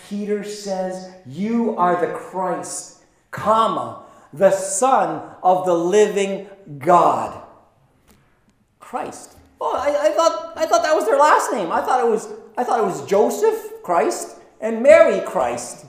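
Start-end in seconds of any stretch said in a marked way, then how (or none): 1.2-7.4 slow, each word spelled out; slow solemn reading. Shown as a demonstration. Preacher reading the confession slowly to the congregation. 8.8-15.8 much higher, puzzled voice; mock naive listener. Preacher mocking a confused believer in a high, puzzled voice.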